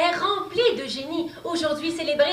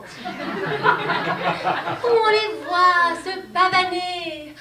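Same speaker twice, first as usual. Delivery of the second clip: mocking drawl; 5 semitones higher, 3 dB louder, much slower than usual. Mocking the award-show actors.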